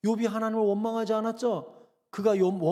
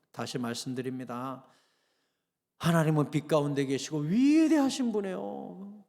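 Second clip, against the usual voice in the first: ordinary talk, nothing marked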